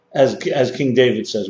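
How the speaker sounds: usual speaking voice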